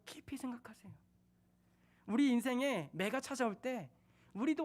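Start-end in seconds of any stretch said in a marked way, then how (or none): 0.1-0.9 whisper. Real, not acted. Repeated whisper.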